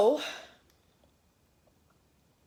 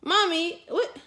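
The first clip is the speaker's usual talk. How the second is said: put-on high, eager little boy voice; little son asking; 8 semitones higher than usual. Son asking mommy for help, high and eager. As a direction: pipe up like a small child wanting help. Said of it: "My son comes in the room".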